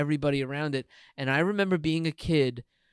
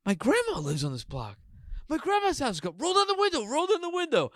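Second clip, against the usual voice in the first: imitating excited kid; 12 semitones higher than usual. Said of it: "and you go".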